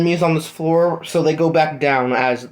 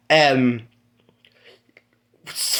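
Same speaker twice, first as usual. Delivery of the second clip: ordinary delivery, nothing marked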